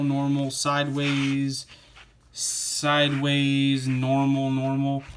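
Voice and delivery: slow, drawn-out mock tilt of the voice; tilting sideways. Shown as a demonstration. Reader showing how the page text tilts sideways. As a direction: lean the words over sideways. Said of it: "going off onto these, like, sideways".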